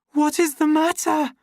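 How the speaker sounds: high, loud, almost screaming; a frightened near-scream. A narrator's reading. Lenina to the raging savage, almost screaming. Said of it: "she almost screamed".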